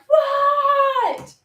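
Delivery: loud, very high, held-out what; mom shouting. Retold or imitated. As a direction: finally blow up at the nagging kid. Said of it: "And finally, you're like".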